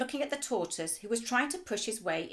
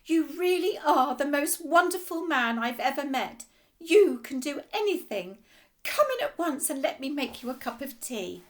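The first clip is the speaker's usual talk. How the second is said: cried out; 5 semitones higher, 6 dB louder than usual. Acted. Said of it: "and cried out".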